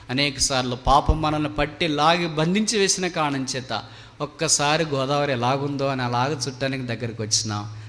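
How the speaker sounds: usual speaking voice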